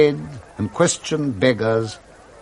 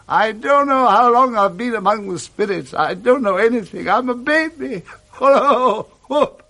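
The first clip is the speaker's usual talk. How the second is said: put-on high, bubbling overjoyed old man; a miser suddenly light as a feather; giddy old Scrooge; 9 semitones higher than usual. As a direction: Scrooge beside himself with joy on Christmas morning.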